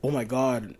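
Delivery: ordinary talk, nothing marked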